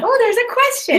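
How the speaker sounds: high, bright mock surprise; surprised. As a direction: pop up in surprise at a question. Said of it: "I was like".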